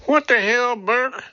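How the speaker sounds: high, disbelieving exclamation; baffled outburst. Acted. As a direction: voice jumps up in shocked disbelief.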